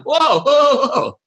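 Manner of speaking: high, loud startled whoa. Retold or imitated. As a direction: back off, startled. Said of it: "he's like".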